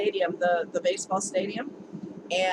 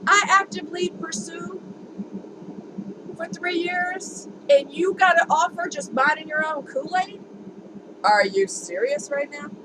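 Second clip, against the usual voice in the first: exasperated outrage at an unfair break; mad; 6 semitones higher than usual. Retold or imitated. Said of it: "I was mad".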